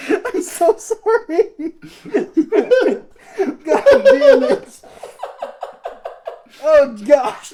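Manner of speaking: apologizing through real laughter, slow; laughing fit. Real, not acted. Breaks character, can't stop laughing.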